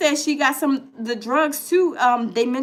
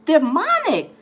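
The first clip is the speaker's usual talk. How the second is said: outraged; 7 semitones higher than usual. Retold or imitated. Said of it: "I was like".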